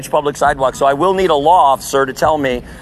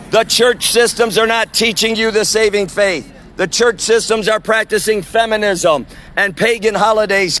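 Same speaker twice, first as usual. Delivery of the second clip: street sermon; 5 semitones higher than usual. Real, not acted. Street preacher warning the crowd outside the event, preaching. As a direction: call the passers-by to repent.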